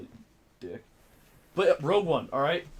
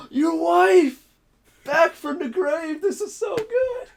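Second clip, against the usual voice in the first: much higher and louder, overjoyed; a mock hysterical celebration; hammed-up elated cry; 11 dB louder, much slower than usual. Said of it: "It was like".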